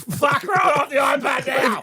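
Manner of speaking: mock yell. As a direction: tell the kid off, fed up. Parent yelling at kid.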